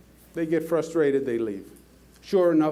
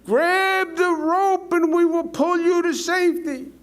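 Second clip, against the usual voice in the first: raised, higher call from above; yelling down; 10 semitones higher, 4 dB louder than usual. Retold or imitated. County officials yell down from the helicopter, raised call. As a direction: holler it down over the rotor noise. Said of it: "yell down".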